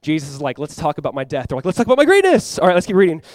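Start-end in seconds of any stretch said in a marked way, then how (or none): 1.6-2.6 loud, high mock-eager boast. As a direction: blurt it out high, like a glory-hungry disciple. Disciples voiced boasting over Jesus.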